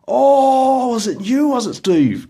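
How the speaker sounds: high, loud, mock amazement; Troy realising who took his licence, loud and surprised; surprised friend. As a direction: exclaim the discovery playfully. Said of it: "And he goes".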